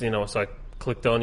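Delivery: ordinary talk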